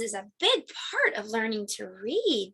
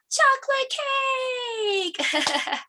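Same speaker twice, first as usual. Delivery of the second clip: yelling; 13 semitones higher than usual. Pretend. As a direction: gasp then a high yelled answer. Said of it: "I want you to yell out the answer".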